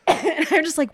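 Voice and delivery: ordinary talk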